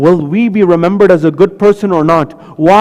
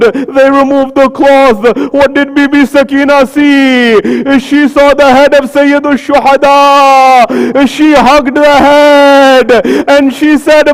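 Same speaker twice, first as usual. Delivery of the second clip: mourning recitation; 4 semitones higher, slower than usual. Acted. Preacher drawing the mourners into Sakina's grief, slow rising lament. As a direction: build the grief toward the climax.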